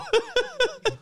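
high pitched burst of laughter. Real, not acted. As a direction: squeal with laughter. Laughs at himself.

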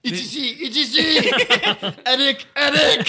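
high, loud shriek breaking into laughter; excited squawk. Retold or imitated. Host mimicking a movie cry for co-hosts, high laughing squawk.

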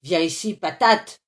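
grumpy yell, building to loud; cross old man hollering. A narrator's reading. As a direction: order the kid over, mildly yelled. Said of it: "hurla-t-il".

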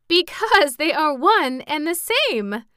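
high, loud, drawn-out merriment; merry. A narrator's reading. Girl revealing the joke to the Scarecrow, merry. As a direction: announce it gleefully, slow and high. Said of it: "now laughing merrily".

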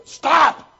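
sudden high, loud command; God grabbing his hand mid-bite, sharp shout; stern shout. Pretend. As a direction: slam the brakes on that bite.

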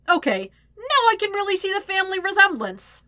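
high, loud sarcastic crack; exaggerated, high-pitched wisecrack; quipping. A narrator's reading. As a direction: exclaim it as a mocking jab. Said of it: "Sokka quips".

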